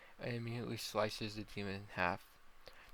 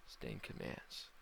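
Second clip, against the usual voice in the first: ordinary delivery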